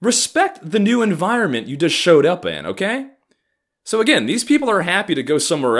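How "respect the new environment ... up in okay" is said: a hint of a higher preachy voice; lightly voiced lecture to a newcomer; mild mock higher scold. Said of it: "it's like".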